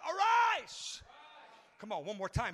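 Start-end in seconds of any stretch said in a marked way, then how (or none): 0.1-1.0 high, ringing "arise"; shouted command. Shown as a demonstration. Preacher showing congregation what to shout, high ringing call. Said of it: "Someone shout".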